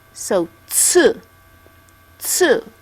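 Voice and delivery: tsu said with a tight ts at the start; sharp t into s for tsu; tsu sound. Shown as a demonstration. Teacher modelling tsu for the students. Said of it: "it's a T S U".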